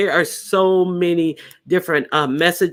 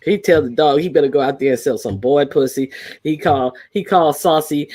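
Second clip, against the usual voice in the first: ordinary talk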